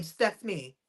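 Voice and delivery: "Stephanie" said in place of [name]; imitated mispronounced name. [name] mimicking how West Indians say her name, "Stephanie" for [name]. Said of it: "'Cause they can't say [name]".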